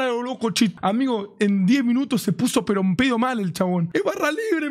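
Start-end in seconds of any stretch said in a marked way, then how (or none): 3.9-4.7 high-pitched imitation of an excited friend; mock excited yell. Drunk friend raving about free bar, mock excited yell. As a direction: mimic a friend's yell without real volume.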